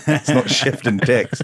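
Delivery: slow, laughing through the words; laughing repeat. Real, not acted. Comic repeating the line to his friends, laughing. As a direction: savour the punchline while cracking up.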